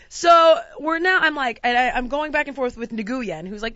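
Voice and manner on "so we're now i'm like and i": ordinary delivery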